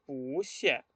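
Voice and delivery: "Pu xie" with rising "Pu", falling "xie"; second-tone pu. Shown as a demonstration. Teacher confirming the tone answer, "Pu xie" with rising Pu. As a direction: lift "Pu", drop "xie". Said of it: "Qué tono pusieron".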